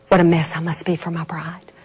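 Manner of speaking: unvoiced, low whisper; whispered thought. Old man thinking of his bride, whispered. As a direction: whisper it, near tears.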